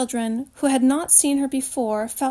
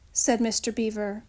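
ordinary delivery

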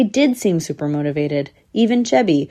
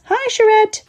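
very high pup calling hi; high, piping kiddie greeting; young squirrel pup; 4 dB louder than usual. A narrator's reading. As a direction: pipe a greeting like a little pup. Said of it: "said one of the pups".

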